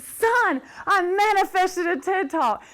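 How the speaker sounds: loud, high-pitched re-enacted thrill; excited announcement. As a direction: break big news to your son, acted out. Acts excited call to her sons.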